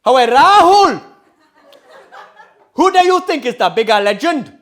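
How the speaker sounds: booming Geordie-Indian dad impression; Geordie-Indian accent. Dad quizzing his son on legends, booming Geordie-Indian accent. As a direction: call out loudly in mixed Geordie and Indian. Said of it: "It's a hybrid between Geordie and Indian".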